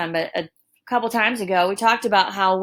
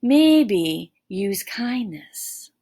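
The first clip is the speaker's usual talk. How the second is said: slow emphasis; much slower than usual. Shown as a demonstration. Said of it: "a reaction that is in your favor".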